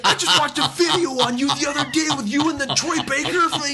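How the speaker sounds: very high, loud excited fan imitation; starstruck gushing. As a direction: gush at full pitch like a thrilled fan. Imitating a thrilled fan recognizing him.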